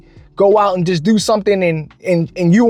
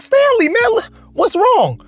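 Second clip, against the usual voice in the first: high-pitched, puzzled "what's wrong?"; high, drawn-out acted concern; concerned questioning; 12 semitones higher than usual. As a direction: ask a coworker in a much higher voice. Acts out his concerned question to coworker.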